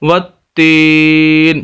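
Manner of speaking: वत्तीन with a hard doubled त and final न stopped; slow वत्तीन held long on ती; pause form. Shown as a demonstration. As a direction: end वत्तीन on a bare न. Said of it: "यहाँ पर वक्फ़ करेंगे".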